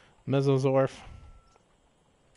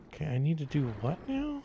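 ordinary talk, nothing marked

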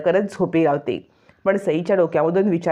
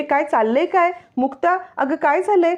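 much higher, worried burst; bursting in to ask what happened; alarmed question; 9 semitones higher than usual. Acted. Madhavi rushes in alarmed. (घाबरून उंच आवाजात विचारणा)

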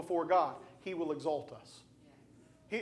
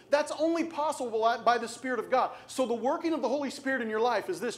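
normal talking voice

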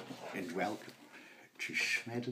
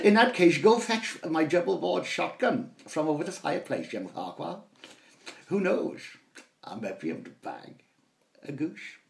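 louder, higher plummy command; hammed-up hunting gentleman; posh father ordering; 5 semitones higher, 7 dB louder than usual. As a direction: send the boy for the shotgun.

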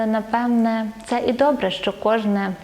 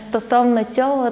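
ordinary talk

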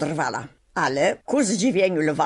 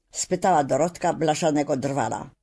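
ordinary delivery

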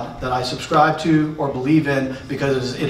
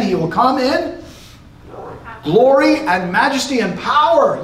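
much higher, soaring up to power; rising proclamation; 8 semitones higher than usual. Real, not acted. Preacher to congregation, proclaiming the return in glory, soaring high. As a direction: lift it up like a herald announcing a king.

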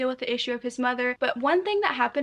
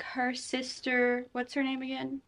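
normal talking voice

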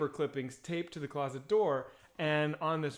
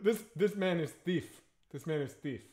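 imitated African accent. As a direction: put on a local African translator's accent. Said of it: "I'm not gonna do the accent".

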